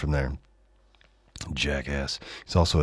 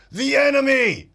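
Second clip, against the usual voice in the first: "the enemy" squealed much higher, mocking capital letters; mock all-caps outburst. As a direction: shriek "the enemy" like a ranting all-caps post. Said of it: "In all caps, exclamation point".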